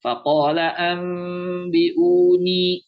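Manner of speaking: "Faqala am biuni" chanted slowly, lips slightly apart on the long m; slow level chant, veiled m in "am biuni"; furjah recitation. Shown as a demonstration. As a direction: leave a small lip gap as you hum the m in "am biuni". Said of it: "Yang furjah".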